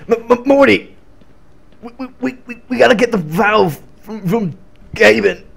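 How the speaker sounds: high, stammering cartoon scientist; hammed-up stuttering rant to Morty; stuttering mad scientist. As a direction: sputter it like a manic grandpa inventor.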